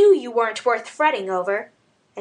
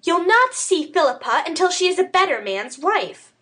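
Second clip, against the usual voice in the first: higher woman's voice, dug in; stubbornly; 8 semitones higher than usual. A narrator's reading. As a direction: refuse and won't budge. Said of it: "she said stubbornly".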